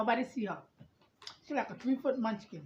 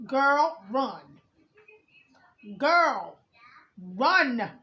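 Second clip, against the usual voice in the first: louder, pressing call to flee; urgent warning; 7 dB louder, much slower than usual. Real, not acted. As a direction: warn her loudly to get out. Urgent warning to the letter writer.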